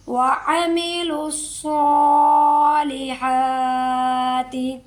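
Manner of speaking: slow, drawn-out tajweed chant; Quran recitation. Shown as a demonstration. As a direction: recite it like a qari, stretching each sound. Recitation demo. (धीमी तजवीद वाली तिलावत)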